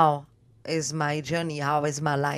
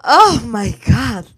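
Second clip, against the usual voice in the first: loud, high, slowly drawn-out exclamation; theatrical amazement; 7 semitones higher, 14 dB louder, much slower than usual. Real, not acted. Guest reacts to the gold, loud drawn-out amazement. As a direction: stretch it out like a diva.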